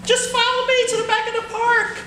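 high, cartoonish little monkey voice; talking monkey. Caged monkey begging the boy to free him, high voice. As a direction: squeak it out like a tiny caged monkey.

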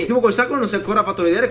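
ordinary delivery

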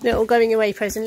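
ordinary delivery

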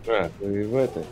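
normal talking voice

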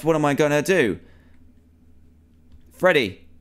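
usual speaking voice

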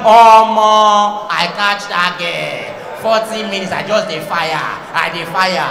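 cocky pidgin boast, slow and loud; mock bragging guy. Man bragging to friends about his night, cocky boast. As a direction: brag like a swaggering lover. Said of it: "You not be bragging".

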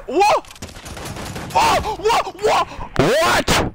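rapid, high, loud cries of disbelief; escalating gamer shock; shocked shouting. Real, not acted. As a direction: yell it over and over, stunned. Repeated shock at game event.

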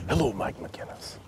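slow, high, whispery greeting; mock summoning. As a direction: breathe the greeting softly, as a joke.

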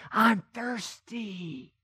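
slow, soft dying rasp; a faint, slow plea from the cross; weak faint voice. Retold or imitated. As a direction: barely get the words out, fading. Dying Jesus speaks from cross.

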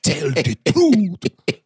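drawn-out mock Nigerian accent; Will Smith's Nigerian accent. Mocking a movie line to a Nigerian friend, exaggerated Nigerian accent. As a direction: stretch it out in a hammed-up Nigerian accent. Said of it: "Will Smith doing an acce-, accent".

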